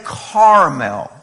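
CARAMEL stretched out with a broad "car" start; kids' pronunciation. Retold or imitated. Kids repeat their question to dad, CARAMEL drawn out as CAR-a-mel. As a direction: draw out CAR-a-mel, barely keeping a straight face. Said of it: "I can't even say it with a straight face".

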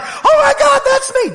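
a caught-out listener's mock horror; mock shocked gasp. Pastor mimicking a listener who recognizes himself, mock shocked squeal. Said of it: "don't be like".

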